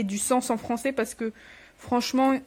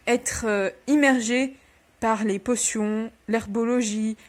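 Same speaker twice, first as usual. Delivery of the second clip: ordinary delivery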